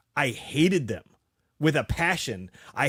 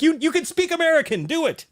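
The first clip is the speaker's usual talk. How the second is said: high, louder mock demand; indignant retold order, pitched way up; pissed off; 12 semitones higher, 5 dB louder than usual. Ordering British actors to speak American, pissed off. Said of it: "I'd get pissed".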